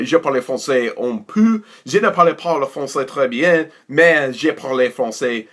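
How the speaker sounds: speaking French with a put-on French accent. Shown as a demonstration. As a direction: slip into French, self-mocking. Switches into French.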